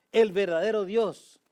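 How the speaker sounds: loud, high, fervent preaching; full-force preaching. Acted. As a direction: drive it home from the pulpit, loud and high. Preaching burst.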